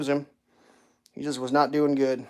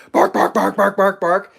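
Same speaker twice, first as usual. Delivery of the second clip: mock barking; 4 semitones higher, 6 dB louder than usual. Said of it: "It's always barking".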